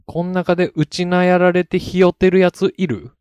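loud, high-pitched Okinawan-style anime rallying cry; mock Okinawan accent. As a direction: shout the gang challenge in a clumsy Okinawan accent. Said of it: "東京リベンジャーズ沖縄バージョン". (沖縄なまり風に高く叫ぶ)

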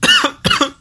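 high fake cough fit; hammed-up cough. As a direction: cough theatrically again.

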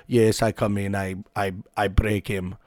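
a hint of a stoic Russian fighter boasting; mock Russian accent. Russian fighter boasting at a press conference, light mock Russian accent. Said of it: "a good Russian accent".

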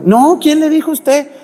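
high, loud mock scolding; shrieked-up indignant rebuff; rude indignant patient. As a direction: imitate an outraged patient telling off the visitor. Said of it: "me trataban bien mal".

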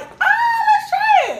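very high, loud fake squeal of joy; hammed-up thrilled girlfriend; mock delight. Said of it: "I'd be like".

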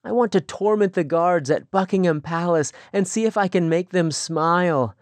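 put-on young woman longing for the world, excited. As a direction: pour out her dreams with growing eagerness. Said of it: "there was excitement in her voice".

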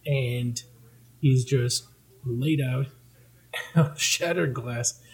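ordinary talk

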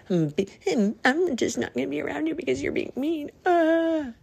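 high, fast, whiny mock complaint. Imitating someone offended, high and whiny. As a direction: whine like a hurt child. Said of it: "I'm gonna go".

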